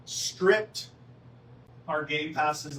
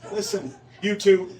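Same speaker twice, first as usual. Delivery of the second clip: loud, slow telling-off; raised voice, drawn-out words; calling to order; 3 semitones higher, 8 dB louder than usual. Real, not acted. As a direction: slowly rein in the two talkers. Calling two chattering people to order.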